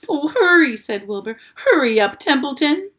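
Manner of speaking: high, loud hurrying plea; urgent. A narrator's reading. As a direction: urge him on frantically. Wilbur urging the rat.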